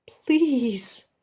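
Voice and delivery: breathless broken whisper; desperate whispered plea. Acted. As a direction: beg in a cracked whisper. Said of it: "Ed's voice breaks".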